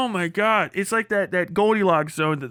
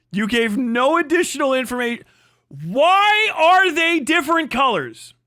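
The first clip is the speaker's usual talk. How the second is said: frustrated demand; 5 semitones higher, 3 dB louder than usual. Real, not acted. Demanding from the co-host in a much higher, louder frustrated voice. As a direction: press him hard for an answer.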